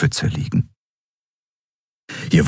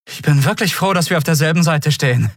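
nervous relief; 10 semitones higher than usual. Acted. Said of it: "ihr trommelndes Herz unter Kontrolle".

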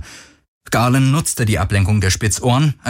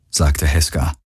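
ordinary delivery

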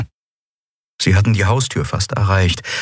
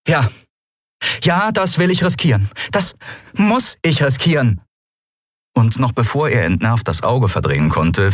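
rising to a fast, high plea; defiant captain pleading with her friend; determined, pleading captain; 10 semitones higher than usual. Acted. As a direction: beg him to understand. Endriel pleads with Kero.

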